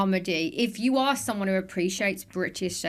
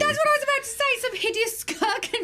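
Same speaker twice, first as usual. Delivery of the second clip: excited. Real, not acted. Excited overlap, laughing at the line.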